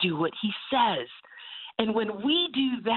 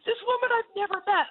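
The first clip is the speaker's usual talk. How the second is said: high laughing cry of embarrassment; can't stop laughing at her own blunder; laughing at herself; 14 semitones higher than usual. Real, not acted. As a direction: squeal through the giggles.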